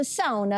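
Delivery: "sauna" as SOW-na inside English talk; Finnish pronunciation. Retold or imitated. Co-host asking Cynthia about her day, Finnish "sauna". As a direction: drop a Finnish SOW-na into the question. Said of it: "You smashed the word".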